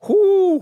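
high, loud whoop of anticipation; long high-pitched "ooh"; thrilled, rising hoot; excited "ooh". Real, not acted. Excited at imagined playoff matchup.

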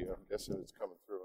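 talking through gusts of wind; wind on mic. Real, not acted. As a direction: wind noise over the mic. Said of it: "Sorry for the wind noise".

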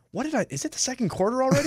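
high-pitched, rushed confused question; mock bewilderment. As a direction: act out a puzzled fan turning to a buddy. Acts out asking his buddy.

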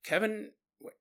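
halting, stunned question with pauses; trying to process. Retold or imitated. Miles hearing that Kevin got hit by a bus, stunned. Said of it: "he's trying to process all of this".

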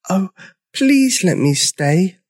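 high, drawn-out little plea; begging. A narrator's reading. Lonely newcomer to the others, begging. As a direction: plead slowly in a tiny dinosaur voice. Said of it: "begged Sizo".

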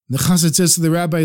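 ordinary delivery, nothing marked

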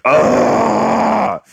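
sudden high strained grunt of frustration; one sharp squeezed-out yell into a pillow; mock frustrated yell. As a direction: let out a high, pained ugh. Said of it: "just yell and just be like".

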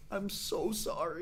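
soft, slow, tearful apology; a shaky, teary sorry, barely voiced; imitating a ref close to crying; near tears. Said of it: "on the verge of tears".

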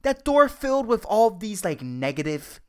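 usual speaking voice

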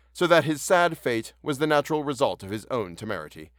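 formal, measured storybook reading; story narration. A narrator's reading. Narrator closing a tale for listeners, formal narration. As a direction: read it like a solemn printed report. Reads story aloud before outro.

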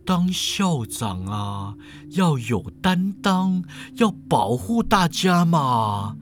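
slow, drawn-out lecturing voice; measured, teasing professor; unruffled old professor. Acted. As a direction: advise at a leisurely pace, stretching the endings.

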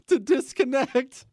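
trying not to laugh while reading; laughter bubbling up mid-sentence; breaking into laughter. Real, not acted. Host losing it reading about the hacked meeting, breaking into laughter. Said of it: "I can't stop laughing".

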